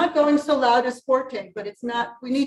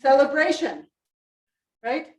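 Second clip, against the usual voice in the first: ordinary talk, nothing marked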